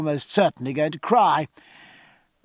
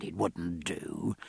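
much softer, lower and slow "it wouldn't do"; 10 semitones lower, 13 dB quieter, slower than usual. A narrator's reading. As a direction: gently let it drop, soft and slow. Jack repeating the refusal gently.

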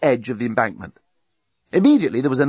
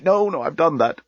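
higher, fretful self-correction; flustered moaning; 5 semitones higher than usual. A narrator's reading. Bomb losing count, flustered moaning. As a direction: moan in confusion. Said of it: "moaned the bomb".

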